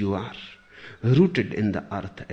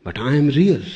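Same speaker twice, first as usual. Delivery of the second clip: Alice protesting, higher and much louder; raised-voice objection from a story character; insistent protest; 4 semitones higher, 9 dB louder than usual. Acted. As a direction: insist on it loudly, pitch lifted. Alice protests in acted dialogue.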